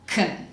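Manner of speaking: KUN with the vowel cut; k straight to n in KUN; reduced can. Shown as a demonstration. Teacher points out middle word, reduced can. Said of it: "in the middle we have kun".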